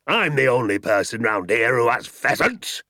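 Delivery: loud, indignant bluster; angry yell. A narrator's reading. Landowner bellowing his claim at the father. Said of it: "yelled Mister Hazel".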